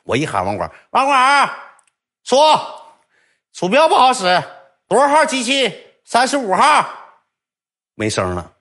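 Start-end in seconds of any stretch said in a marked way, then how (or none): 0.9-6.9 high, loud shout across the room; mock shouting for the net cafe attendant; calling out. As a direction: yell for the attendant downstairs. Calling the admin from upstairs.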